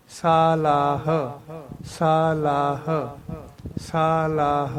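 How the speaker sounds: ਸਲਾਹ said slowly as saa-laah with final h; careful pronunciation. Shown as a demonstration. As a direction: spell out ਸਲਾਹ with long vowels and a breathy h. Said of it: "ਇਸ ਤਰ੍ਹਾਂ ਬੋਲਣਾ". (ਸਲਾਹ ਸਾਫ਼ ਤੇ ਲੰਮਾ, ਅਖੀਰ ਤੇ ਹ)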